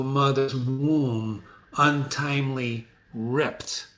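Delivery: slow, weighty revelation; slow triumphant reveal. Acted. Macduff revealing his birth to Macbeth, slow weighty. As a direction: lay out each word slowly.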